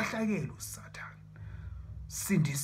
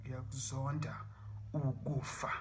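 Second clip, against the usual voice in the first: low, soft declaration of hate; hushed loathing; 4 semitones lower, 9 dB quieter than usual. Real, not acted. Declaring hatred of death, low and hushed. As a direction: say it low, under your breath.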